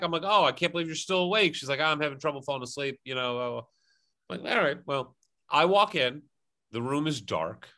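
ordinary delivery

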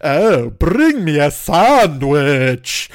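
very slow, drawn-out haughty order; card players hear the Earl order, slow and lordly; Earl of Sandwich impression. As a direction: stretch it out like a pampered nobleman. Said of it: "That is a hilarious Earl of Sandwich impression".

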